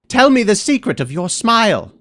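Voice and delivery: high-pitched call, pleading for an answer; calling after him. Acted. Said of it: "I called after him".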